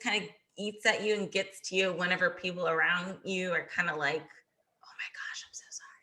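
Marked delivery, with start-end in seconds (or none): 4.2-5.9 pitying whisper. Retold or imitated. Mimicking people consoling her after a loss, in a pitying whisper. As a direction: breathe it out like a sad secret.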